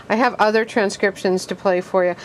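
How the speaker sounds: normal talking voice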